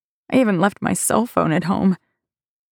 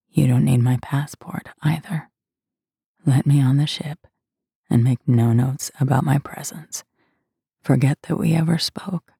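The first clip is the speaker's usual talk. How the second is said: low commanding siren whisper; luring spirit whisper; 4 semitones lower than usual. Acted. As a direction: cast a spell with a hushed command. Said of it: "my siren voice slips from my lips to his ear".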